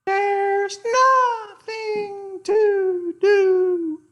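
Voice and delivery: slow, high cooing mnemonic; mourning dove call. Shown as a demonstration. Teacher demonstrating the mourning dove's call, slow high coo. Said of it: "That's a mourning dove, the way I remember it".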